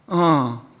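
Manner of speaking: a faint, low, barely-there "어"; half-dead voice. Retold or imitated. A schoolgirl in a taxi answering her dad on the phone, barely alive. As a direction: answer like you have no strength left. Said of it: "다 죽어가는 목소리로요".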